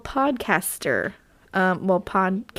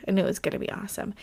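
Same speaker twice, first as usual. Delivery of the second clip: fast, softer muttered aside; quick quiet aside; 8 dB quieter, much faster than usual. Real, not acted. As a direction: rush it under the breath. Repeats line as quick aside.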